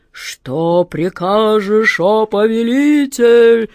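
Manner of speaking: slow booming genie voice. Acted. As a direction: thunder it out slowly, like a genie. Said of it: "прогремел Джин".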